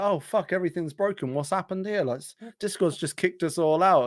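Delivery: high, alarmed voice; panicked confusion. Retold or imitated. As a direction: sound like everything just broke. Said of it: "We were like".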